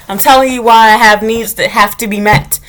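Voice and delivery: ordinary delivery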